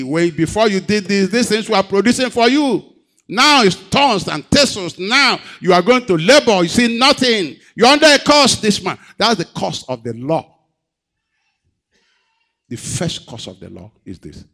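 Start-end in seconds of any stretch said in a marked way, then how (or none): 0.0-10.5 very high, loud preaching rebuke; fiery preaching. Acted. Preacher rebuking the congregation for lost zeal, fiery and loud. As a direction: challenge them at full force.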